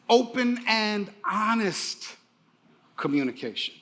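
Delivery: slow emphatic phrase. Shown as a demonstration. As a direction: stretch out open and honest, then let communication drop away. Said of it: "One more time".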